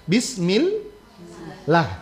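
Bismillah slow, lam light and thin. Shown as a demonstration. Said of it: "Nah, gitu ya". (Bismillah, lam tipis dan pelan)

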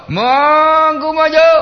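very high, drawn-out marching order; parade command. Retold or imitated. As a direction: stretch it out like a ceremony commander. Aba-aba upacara ditiru. (aba-aba maju, melengking dan panjang)

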